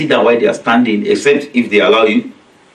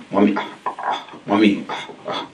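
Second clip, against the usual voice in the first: low, soft mock tough-boy mumble; deepened voice; 4 semitones lower, 8 dB quieter than usual. Shown as a demonstration. Boys changing their tone to parents, mock-deep and low. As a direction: imitate a boy putting on a deeper, quieter voice. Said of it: "they make it deeper".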